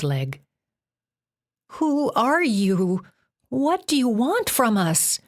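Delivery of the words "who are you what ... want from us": put-on higher, slow girl's voice, teeth chattering; frightened Jen. A narrator's reading. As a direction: plead like a freezing, scared girl. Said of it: "asked through her chattering teeth".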